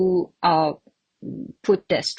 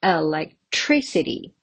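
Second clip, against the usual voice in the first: Electricity with TRI stressed; teacher's model of elecTRIcity; stress on third syllable; 2 semitones lower than usual. Shown as a demonstration. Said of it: "On the third syllable".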